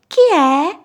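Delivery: high, drawn-out piglet call; high-pitched questioning piglet; little pig. Acted. Little pig answers the door.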